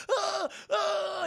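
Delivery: high-pitched mock wails of pain; mock screaming. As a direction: imitate a panicked, wounded demon's cries. Blinded demon screaming in panic.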